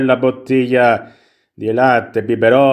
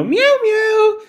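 very high, drawn-out meows; playful cat meow. Pretend. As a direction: mimic a kitten meowing. Imita il gatto nero.

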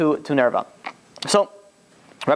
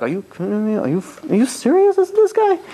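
incredulous outburst; 8 semitones higher than usual. Retold or imitated. Bystanders outraged at the plate.

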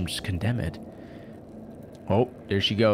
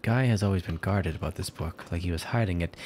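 ordinary talk

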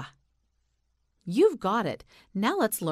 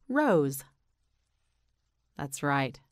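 usual speaking voice

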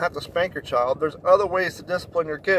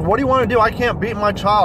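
higher, louder defensive mom; exasperated mom; 5 semitones higher, 5 dB louder than usual. Retold or imitated. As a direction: whine it like a cornered mother. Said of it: "And she's like".

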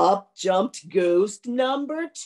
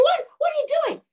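screaming ghost; faster than usual. Acted. Ghost screams as its ribs are carried uphill, high mock scream. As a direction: shriek the question in mock alarm. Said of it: "the ghost was screaming".

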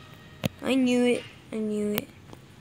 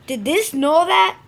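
louder, slower outraged question; outraged repeat; 4 semitones higher, 8 dB louder than usual. Real, not acted. Gamer rages at the map, loud outraged question. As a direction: demand it indignantly.

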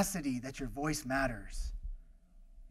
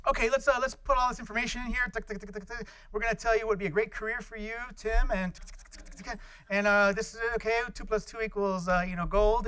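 a hint of a chirpy counselor, higher; mock counselor; 5 semitones higher than usual. Counselor feeding the computer, lightly mocked. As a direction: play the cheery counselor lightly. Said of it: "the career counselor would say".

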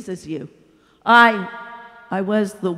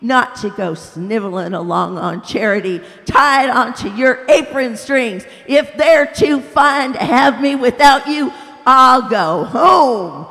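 loud, higher furious tirade; furious rant; 4 semitones higher, 9 dB louder than usual. A narrator's reading. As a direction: rage and refuse to go. Ghost rants, refusing.

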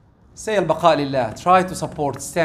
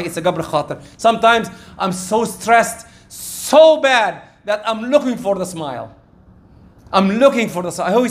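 ordinary talk

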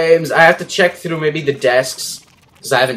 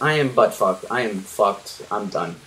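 ordinary talk, nothing marked

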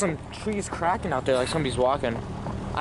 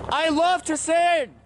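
slow, high, raised voice; calling out to spirits; 11 semitones higher, 4 dB louder, slower than usual. Real, not acted. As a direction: sing it out into the night.

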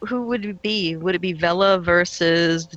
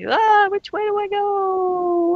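mock panic; 10 semitones higher than usual. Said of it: "you get panicked underwater, and it's like".

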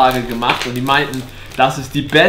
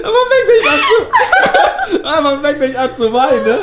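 laughing; 11 semitones higher than usual. Real, not acted. Laughing while tasting sour.

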